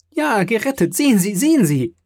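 high, loud excited cries; overjoyed insistence. A narrator's reading. Daughter showing father the purse, overjoyed insistence. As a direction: urge him to look, elated.

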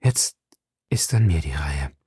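low, halting and grave; trembling. A narrator's reading. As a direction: say it deep down, pausing after the first word. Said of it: "selber bebend, wie ein Löwe".

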